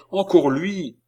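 high-pitched alarm; effroi. A narrator's reading. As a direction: insist in alarm that he's back. Girl cries out recognising intruder. (effroi, voix aiguë)

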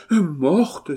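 high, loud exclamation; shocked cry. Acted. Two brothers echoing the marquise, loud and shocked. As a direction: cry out aghast. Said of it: "s'écrièrent en chœur les deux frères".

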